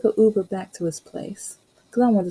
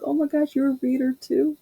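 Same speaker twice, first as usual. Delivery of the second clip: mock surprise; 7 semitones higher than usual. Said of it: "I'm like".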